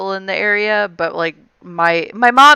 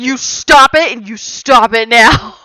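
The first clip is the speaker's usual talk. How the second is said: loud, higher mock scolding; scolding mom; 5 semitones higher, 5 dB louder than usual. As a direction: imitate a mom putting her foot down. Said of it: "my mom's just like".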